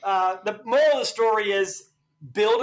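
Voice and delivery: high, loud, fired-up comeback; defiant outburst. Real, not acted. Host answering his YouTube critics, loud and defiant. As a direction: throw it right back at the critics.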